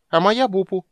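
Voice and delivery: high, loud squeaky gully dwarf voice; tiny squeaky girl voice; squeak. Acted. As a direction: squeak it out like a little creature. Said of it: "пискнула Бупу".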